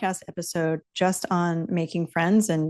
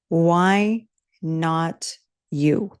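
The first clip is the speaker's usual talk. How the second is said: very slow question; much slower than usual. Real, not acted.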